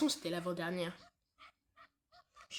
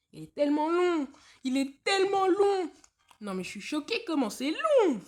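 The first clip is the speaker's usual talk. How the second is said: exasperated; 8 semitones higher, 10 dB louder than usual. Real, not acted. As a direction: moan about the endless loading, loud and high. Exasperated at loading time.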